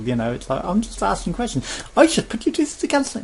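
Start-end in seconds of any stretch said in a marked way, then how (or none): 2.0-3.2 squeaky high, loud sales pitch; cheery pushy salesperson. Retold or imitated. Sky agent pushing cancellation, high cheery salesperson voice. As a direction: ham up an eager agent rushing him through.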